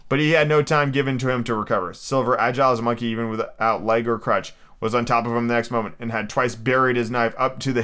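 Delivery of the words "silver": ordinary delivery, nothing marked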